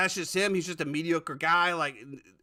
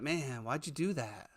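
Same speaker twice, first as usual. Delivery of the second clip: mopey shrug; 6 semitones lower, 12 dB quieter, slower than usual. Retold or imitated. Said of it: "he's gonna be like".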